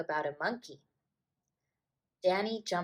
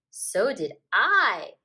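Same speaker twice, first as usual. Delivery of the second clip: put-on slow, louder little girl; proud girl; 8 dB louder, slower than usual. A narrator's reading. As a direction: proudly join in. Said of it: "said Anna Maria".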